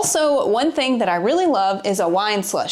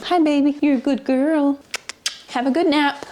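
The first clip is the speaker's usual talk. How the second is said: talking to the dog; 6 semitones higher than usual. Real, not acted. Greeting the sleeping dog.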